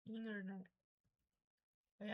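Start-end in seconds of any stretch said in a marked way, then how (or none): 0.1-0.7 "Internet" as "inner-net"; American silent T. Shown as a demonstration. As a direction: drop the first T in "Internet". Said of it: "o T fica mudo". ("Internet" com sotaque americano, T mudo)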